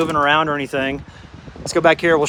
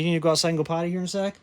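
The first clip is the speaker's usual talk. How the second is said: ordinary delivery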